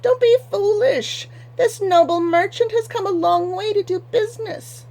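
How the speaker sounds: snapping daughter. Acted. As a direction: snap at him in a high shrill daughter's voice. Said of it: "Anheldo snapped".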